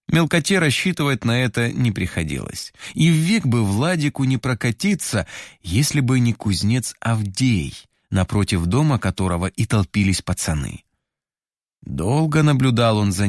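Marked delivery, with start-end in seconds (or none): none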